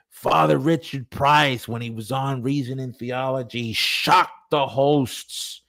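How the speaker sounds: hammed-up breathless headline; mock-scandalized announcement. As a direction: announce it like shocking gossip, drawing out the ending. Mimicking chatter about a shocking interview.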